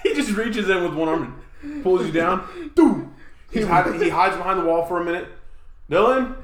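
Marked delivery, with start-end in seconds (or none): 2.7-3.1 sound effect. Retold or imitated. Mimics the dad's hammer fist. 5.9-6.4 dad calling out. Retold or imitated. Voicing dad checking on son.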